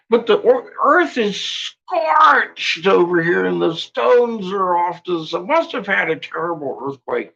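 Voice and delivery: high, slowed, hammed-up expert explaining; mock expert voice. Mocking the archaeologists' official story, in a high mock expert voice. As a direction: parody the archaeologists in a high silly voice.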